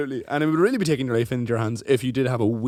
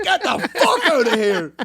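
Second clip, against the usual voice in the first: high, loud laughing scoff; mock dismissal; 9 semitones higher, 4 dB louder than usual. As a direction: throw them out with a laugh.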